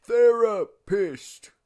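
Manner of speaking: slow, emphatic "Therapist"; drawn-out correction. Pretend. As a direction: spell out "Therapist" syllable by syllable. Repeats the word for listener.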